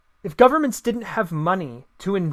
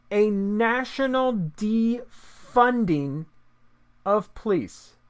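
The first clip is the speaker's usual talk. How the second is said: slow, emphatic reread; 3 semitones higher, 4 dB quieter, much slower than usual. Shown as a demonstration. Rereading the demand to viewers, slow and incredulous. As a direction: lean on each word as if it can't be believed. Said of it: "Let me just read that again".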